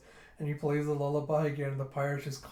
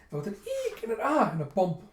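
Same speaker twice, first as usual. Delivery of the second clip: high, loud little eek; mock squeak; 7 semitones higher, 6 dB louder, faster than usual. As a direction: squeal it like a bump. Mimicking collision sounds.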